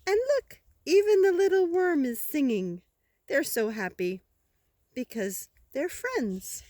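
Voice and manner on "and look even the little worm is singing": delighted. Acted. Delighted reveal to child.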